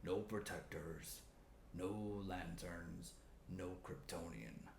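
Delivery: low, slow, hushed villain growl; dark threat, drawn out low; menacing Steppenwolf. Retold or imitated. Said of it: "Steppenwolf says".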